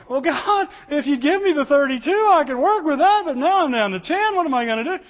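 high, pleading voice of a doubter; high, fretful complaint to God; doubting whine. Pretend. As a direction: whine it to God like you've lost hope. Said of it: "Me and you'd be going".

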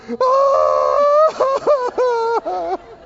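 high, loud mock wail; mock wailing cry. Preacher imitating a wife's days of crying with a high mock wail. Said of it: "here's what you hear".

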